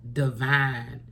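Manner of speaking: ordinary talk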